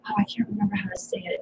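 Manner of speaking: hushed under breath; whispered aside. Real, not acted. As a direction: whisper it to yourself. Aside to self.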